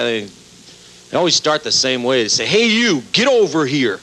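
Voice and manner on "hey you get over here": mock angry call. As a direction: bark it like an irate fan. Angry audience member calling out.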